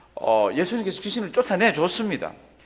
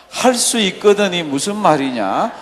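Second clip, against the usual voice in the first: loud, slow, declamatory Bible reading; scripture reading; 8 dB louder, slower than usual. Acted. Preacher leading the congregation in reading, loud scripture recitation. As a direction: lead the congregation through the verse, loudly and slowly.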